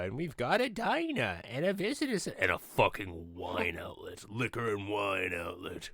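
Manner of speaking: sneering mock ad voice. Mocking state store signage voice.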